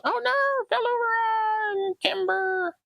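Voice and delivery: high squeaky oh no; a sudden high wail as the piece topples; yelp of dismay. Real, not acted. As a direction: piece falls over, a high cry of dismay. Branch falls over.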